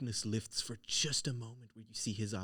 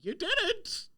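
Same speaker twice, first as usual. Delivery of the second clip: high, loud cackling hag; 10 semitones higher, 6 dB louder than usual. Acted. Hag gloating to the players: high, loud hag voice. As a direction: squeal it out like a gleeful old hag. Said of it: "The hag comes down and goes".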